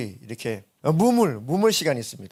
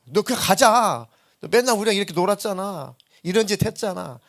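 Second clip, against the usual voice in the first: a touch higher, coaxing friends; friends pushing; 6 semitones higher, 4 dB louder than usual. Retold or imitated. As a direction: nudge him to come along. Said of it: "막 흔들어대죠". (함께 가자고 조르는 친구들 흉내)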